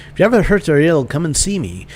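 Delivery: badly faked Celtic lilt; bad Irish accent. Healer inviting Sean back, bad Irish accent. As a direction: put on a shaky-footed Irish brogue. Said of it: "It's the worst Irish accent ever".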